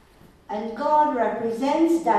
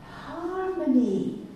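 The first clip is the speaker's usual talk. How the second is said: savoring the word; 6 semitones higher, 4 dB quieter, much slower than usual. Real, not acted. Savoring student's answer.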